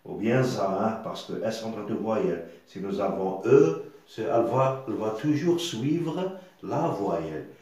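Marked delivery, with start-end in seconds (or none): none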